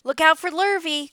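loud, high warning call; cows calling. A narrator's reading. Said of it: "called the cows".

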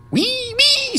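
very high squealed "wee, wee"; high-pitched toy-ambulance sound; high vehicle noise. A narrator's reading. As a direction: squeal like a tiny siren, hard and fast. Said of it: "Hard enough and fast enough".

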